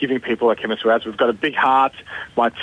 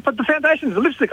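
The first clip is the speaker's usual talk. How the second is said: imitating a squealing, much higher girl's voice; excited young girl; 12 semitones higher than usual. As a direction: gush like a girl who can't believe the bargain. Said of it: "she was hyperventilating".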